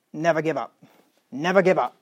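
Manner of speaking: lightly imitated German accent. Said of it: "in her German accent".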